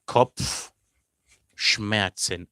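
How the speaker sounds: Kopfschmerzen stretched very slowly, pf and tz spelled out; drawn-out German word. Shown as a demonstration. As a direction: model Kopfschmerzen syllable by syllable. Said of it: "teacher demonstrating pronunciation".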